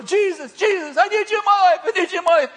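high, urgent pleading cry; shouted prayer. Retold or imitated. Man prays in the sand with speaker, high shouted plea.

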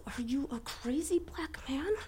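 slow, high hissed whisper; scolding whisper. Retold or imitated. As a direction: whisper it slowly, aghast. Wife scolding husband under breath.

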